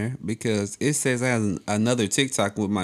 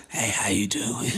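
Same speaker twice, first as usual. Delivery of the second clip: flirty whisper; 2 semitones lower, 6 dB quieter, slower than usual. Shown as a demonstration. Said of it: "I start now with".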